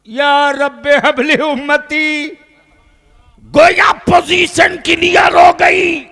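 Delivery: high, ringing, shouted declamation; full-force preaching. Real, not acted. Preacher voices the Prophet's plea for his community, full-force preaching. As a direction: cry out the prayer at full power.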